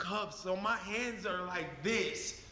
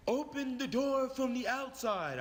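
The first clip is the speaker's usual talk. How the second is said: officer's order, pitched up from the narration; police officer command; 3 semitones higher than usual. Acted. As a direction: issue a firm step-by-step police instruction. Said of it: "one of the officers said".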